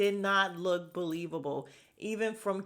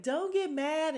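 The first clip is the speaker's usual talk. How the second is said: high, pleading Candace imitation; mock Candace; 10 semitones higher, slower than usual. Vlogger imitating Candace pleading with Karen, high voice. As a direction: mimic her begging not to be mad.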